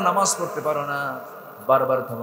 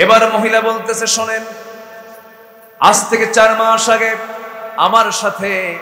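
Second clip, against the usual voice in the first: loud, high preaching build; storytelling preacher at full volume; fiery sermon narration; 9 semitones higher, 14 dB louder than usual. Acted.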